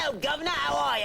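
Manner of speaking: bad British accent, high-pitched; hammed-up Cockney chimney-sweep British accent. Shown as a demonstration. Host showing his failed British accent, hammed-up and high-pitched.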